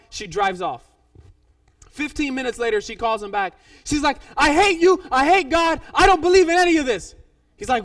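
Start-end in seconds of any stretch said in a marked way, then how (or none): 4.4-7.1 loud, high retold rant; angry outburst. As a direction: act out her furious complaint at full volume. Woman phones pastor in angry outburst.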